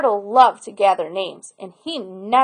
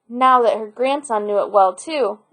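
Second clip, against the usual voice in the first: plain reading voice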